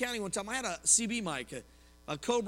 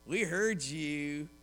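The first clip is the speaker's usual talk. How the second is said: very slow, lower knowing tease; 5 semitones lower, much slower than usual. Retold or imitated. Coworker telling the preacher his mic was stuck, slow knowing tease. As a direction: let it hang, savoring the gotcha. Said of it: "And he comes".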